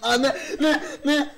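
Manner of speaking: high, very loud laughing no; alarmed shout. Real, not acted. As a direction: cry out in shocked alarm. Monster catching them.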